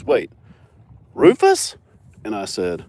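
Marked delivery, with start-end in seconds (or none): 0.0-1.8 much higher, louder imitated surprise; surprised neighbor. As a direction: squeal the name in disbelief. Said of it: "She goes".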